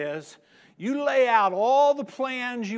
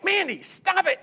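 higher, louder command to the dog; firm scold; 11 semitones higher, 5 dB louder than usual. Retold or imitated. Owner scolding the barking dog, louder. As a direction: snap at the dog.

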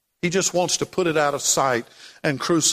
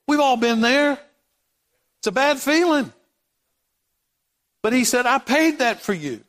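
high, ringing proclamation with pauses; fervent preacher, pitched up; impassioned preaching; 6 semitones higher than usual. Real, not acted. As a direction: proclaim it to the congregation. Preaching at full force.

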